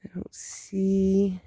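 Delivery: slow whisper. Real, not acted. Vlogger whispering while searching the garden.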